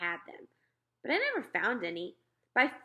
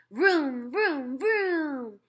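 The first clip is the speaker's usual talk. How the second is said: put-on high, loud toddler motorcycle noises; yelled vroom vroom; 6 semitones higher, 4 dB louder, much slower than usual. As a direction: vroom like a little kid on a bike. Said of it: "he yells".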